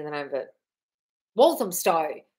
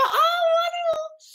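much higher and slow, with a laugh; delighted; slower than usual. Real, not acted. Reacts to a viewer's happy news.

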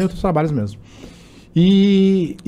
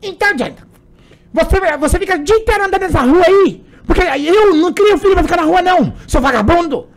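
mock mom yelling at her son; imitating his angry mom; 10 semitones higher than usual. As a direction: scold your son like a furious mom, pitched way up. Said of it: "como que tua mãe faz?".

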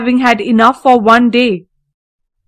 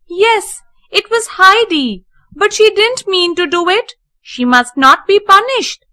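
put-on higher, bright-eyed girl pleading; young girl Clara; 7 semitones higher than usual. A narrator's reading. Clara defends Heidi to the housekeeper, high girlish plea. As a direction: speak up for Heidi like a sly little girl.

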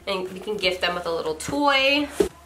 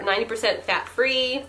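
ordinary talk

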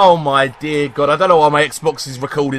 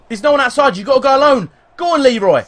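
high, loud, excited urging; urgent cheering on; 9 semitones higher, 6 dB louder, faster than usual. Real, not acted. Gamer sending Leroy on alone, urgent high shouting. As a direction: push him forward with your voice. Urges player on the attack.

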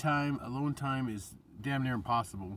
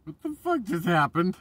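louder, baffled rising question; stunned disbelief; 5 semitones higher, 6 dB louder than usual. Retold or imitated. Vlogger acting himself after people drain him, stunned. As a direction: sound dazed after being drained. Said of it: "I'm sitting there going".

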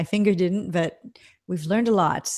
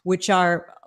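normal talking voice

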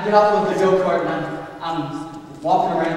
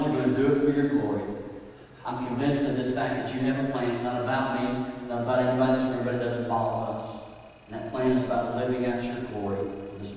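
soft, low prayer voice; 5 semitones lower, 6 dB quieter than usual. Acted. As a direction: pray quietly over the room. Pastor leads opening prayer.

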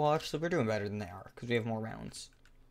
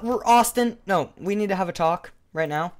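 loud, high scolding; indignant; 7 semitones higher, 9 dB louder than usual. Real, not acted. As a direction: demand a talk, fed up. Killed again, scolds the player.

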